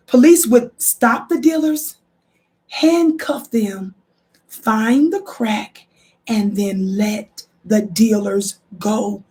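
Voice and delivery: each phrase slowed right down and spaced out; emphatic slow-motion reading; slow, deliberate reread. Shown as a demonstration. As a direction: read it slowly so nobody misses it. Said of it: "I don't think you heard me right".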